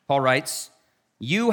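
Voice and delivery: usual speaking voice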